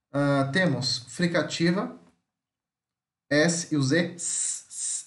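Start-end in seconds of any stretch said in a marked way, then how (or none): 4.1-5.0 a soft hissed s; quiet voiceless s hiss; S sound. Shown as a demonstration. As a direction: hiss "s, s" without voice. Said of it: "esse som, ó".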